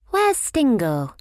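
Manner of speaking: slow, high, suspicious question. A narrator's reading. Fifi questioning Slugsy about his naughty friend, slow suspicion. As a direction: draw it out, not trusting him. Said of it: "asked Fifi suspiciously".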